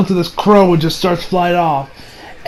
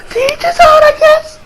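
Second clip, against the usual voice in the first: mock wailing shrug. Shrugging off the father's speech with a wailing "To each his own". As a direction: cry "To each his own" in hammed-up despair. Mock wail, then repeated plainly.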